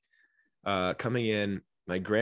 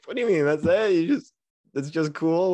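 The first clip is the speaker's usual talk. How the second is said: mock indignant; 8 semitones higher, 7 dB louder than usual. Acting indignant at reaction.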